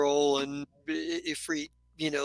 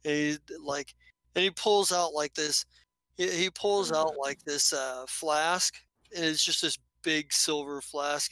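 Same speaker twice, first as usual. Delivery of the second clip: normal talking voice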